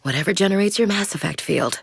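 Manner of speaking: ordinary talk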